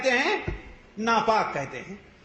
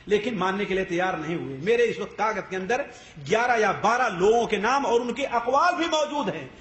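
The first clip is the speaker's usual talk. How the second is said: normal talking voice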